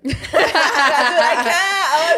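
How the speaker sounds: breaking up laughing, high and slow; can't get words out for laughing. Real, not acted. Friends mock her accent, laughing hard.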